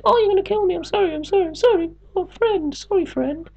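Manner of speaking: mock begging in a very high falsetto; high-pitched mock pleading; apologetic. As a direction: squeal out a grovelling apology, imitating someone scared of being killed. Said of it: "he's now all apologetic. He's like".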